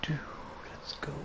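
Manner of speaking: hushed whisper, no voice at all; whispering let's go under the breath. Real, not acted. Quiet start to the action.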